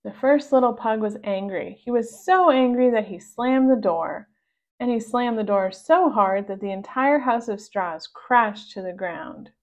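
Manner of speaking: usual speaking voice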